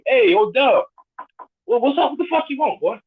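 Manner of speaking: high, playful call and gruff reply; mock street exchange. A friend calling out to Dub and getting a rude reply, done in high mock voices. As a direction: throw both voices back and forth.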